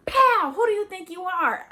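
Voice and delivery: high, loud furious dad demanding an answer; angry father yelling. Retold or imitated. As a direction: yell it down at the child. Father yelling during a whooping.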